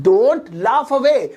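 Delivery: very slow, each word held; slow model repeat. Shown as a demonstration. Teacher repeats the phrase for students, slow and stretched. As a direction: draw out "don't laugh away," word by word.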